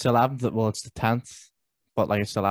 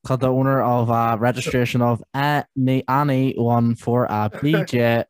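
slow, louder tannoy voice; supermarket announcement; 3 dB louder, slower than usual. Pretend. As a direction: page a customer over the speakers. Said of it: "the Tannoy in Tesco's".

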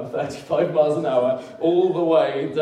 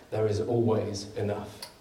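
rising preacher cadence building; preaching; 3 dB louder, slower than usual. Acted. Pastor building to the congregation, preaching. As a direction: preach it with growing conviction.

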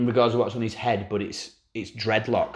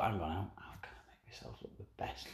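whispered thought; 4 semitones lower, 19 dB quieter than usual. Retold or imitated. Voicing the fan's scheming thought.

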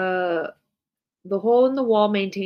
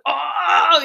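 high, loud mock gasp of "oh"; mock shock; 10 semitones higher, 7 dB louder than usual. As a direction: act caught off guard by the orgy fact. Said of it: "And they're like".

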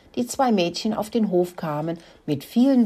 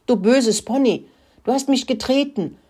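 higher, louder wounded snarl; growled; 6 semitones higher, 4 dB louder than usual. Acted. The dog Mack snarls at the pony after being kicked. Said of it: "knurrte er".

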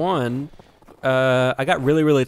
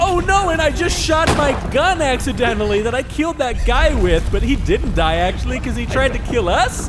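high, loud frantic babble; panicked; 11 semitones higher, 5 dB louder than usual. Pretend. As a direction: rattle it off in a mock panic. Pretend panic in game.

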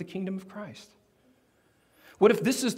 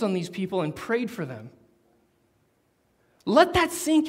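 raised, building sermon voice; preaching at full force; 6 semitones higher, 4 dB louder than usual. Acted. Preacher urging the church, rising to full force. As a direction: drive the point home to the congregation.

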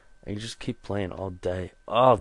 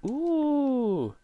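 excited ooh. Real, not acted. Sudden reaction during game.

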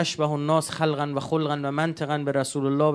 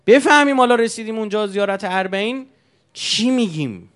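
high, loud, building sermon climax; impassioned preaching; 7 semitones higher, 11 dB louder than usual. Acted. Preacher to mourners, rising impassioned cry. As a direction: cry it out to the crowd.

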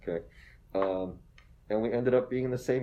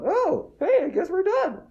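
a much higher, light resigned sigh of a voice; resigned shrug; 4 dB louder, slower than usual. Retold or imitated. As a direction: play it as a couple giving up with a shrug. Couple's resigned thought, voiced.

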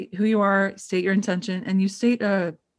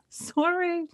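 meek mock sorry; 10 semitones higher, 4 dB quieter than usual. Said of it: "I'm like".

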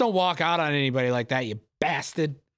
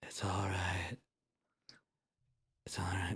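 hushed whisper; 9 semitones lower, 14 dB quieter than usual. Real, not acted. Whispering along to the movie. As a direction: breathe it out under your breath.

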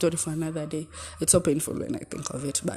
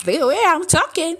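much higher, girlish put-on voice; cheeky high voice; 12 semitones higher, 8 dB louder than usual. Shown as a demonstration. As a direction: show off the old cheeky pitch. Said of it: "that cheeky, cheeky voice".

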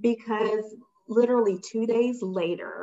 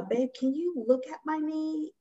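sweet ask; 8 semitones higher, 4 dB quieter than usual. Retold or imitated. Sweetly asking fiancé.

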